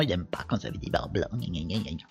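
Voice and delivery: low, soft mock whining; self-mocking grumble. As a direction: grumble nonsense to mock your own fussiness. Mocks his own screen obsession. (ronchonnement moqueur)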